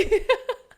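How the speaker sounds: high, loud happy laugh; excited laugh. Real, not acted. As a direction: laugh with joy. Emotional reveal moment.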